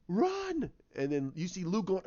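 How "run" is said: very high, urgent cry; screamed warning. Retold or imitated. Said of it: "And she goes".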